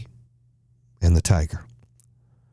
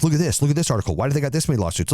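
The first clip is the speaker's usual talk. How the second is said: worked-up retold self-defense, higher pitch; defensive; 6 semitones higher, much faster than usual. Upset man defending his company to critics, defensive. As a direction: argue your case, voice pitched up. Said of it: "I was, like, defending them".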